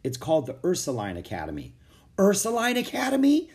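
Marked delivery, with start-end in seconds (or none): 2.2-3.5 high, loud disbelieving question; astonished question. A narrator's reading. Jack hears the school's name and wakes fully, astonished.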